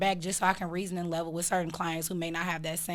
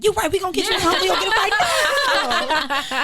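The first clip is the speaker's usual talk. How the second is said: hyped-up reassurance; 11 semitones higher, 7 dB louder than usual. Retold or imitated. Realtor hyping a stressed client on the phone. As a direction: pump the client up, laughing. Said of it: "I've been on the phone like".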